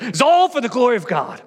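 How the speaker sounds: mock-pious protest. Pastor quotes a colleague's pious correction, mock-pious protest. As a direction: put on a righteous correction.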